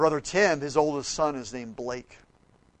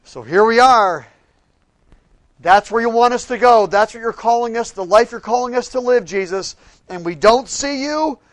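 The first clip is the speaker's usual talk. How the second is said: loud, high, earnest preaching prayer; fervent prayer; 7 semitones higher, 14 dB louder than usual. Acted. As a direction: pray it out with full conviction. Prayer addressed to Jesus.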